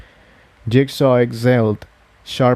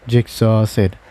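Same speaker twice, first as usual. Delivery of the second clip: ordinary delivery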